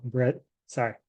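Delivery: normal talking voice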